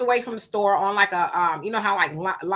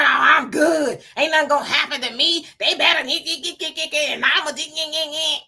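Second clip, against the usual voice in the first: mock overconfident; 4 semitones higher than usual. Host mocking a careless young woman, cocky bragging. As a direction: parody a know-it-all who fears nothing. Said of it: "we gotta unlearn".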